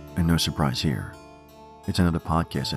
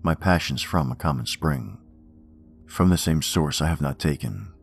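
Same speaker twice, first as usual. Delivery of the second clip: poem reading. Acted. Reads Poe poems aloud.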